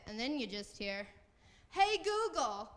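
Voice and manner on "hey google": high, loud mock outrage in Jersey accent; outraged New Jersey mom. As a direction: stretch the call, then rant like a Jersey mother, dropping at the end. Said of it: "she has the very strong accent".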